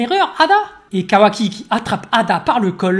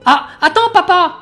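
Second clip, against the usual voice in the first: put-on high, louder girl cutting in; interrupting girl; 10 semitones higher, 7 dB louder than usual. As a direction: stop him before he leaves. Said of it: "Sarada qui l'interrompt".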